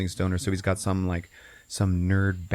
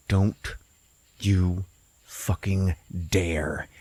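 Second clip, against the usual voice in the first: slow, word-by-word mock threat; retold slow warning; much slower than usual. Frank warning the corn not to sing, slow threat. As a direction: space out every word as a warning.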